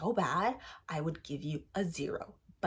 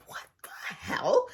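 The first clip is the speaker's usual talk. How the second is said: slow breathy whisper of disbelief; stunned whisper; 4 semitones higher, much slower than usual. Retold or imitated. Whispering in shock at the friend's story.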